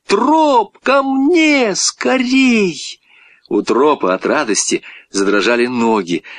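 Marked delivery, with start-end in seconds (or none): 0.1-3.0 high-pitched, drawn-out calling; boy's urgent shout. A narrator's reading. Boy urging his dog to come, shouted high. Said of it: "закричал мальчик".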